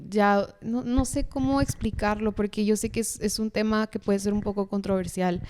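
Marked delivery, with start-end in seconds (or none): none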